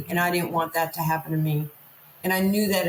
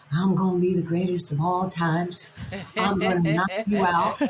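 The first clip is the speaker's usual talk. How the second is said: put-on a ten-year-old putting on the champ's brag voice; kid's Ali impression. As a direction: boast like a little kid copying the champ. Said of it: "Why are you talking like that?".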